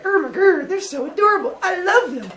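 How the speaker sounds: high, gushing delight over babies; gushing mother. Acted. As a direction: coo like a doting mother. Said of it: "Mother Earth immediately was like".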